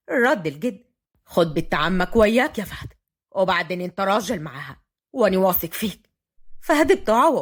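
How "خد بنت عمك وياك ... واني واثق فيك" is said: low-pitched, steady elder; grandfather. A narrator's reading. Grandfather entrusts Watin to Fahd, steady. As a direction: entrust her to him in a lowered voice.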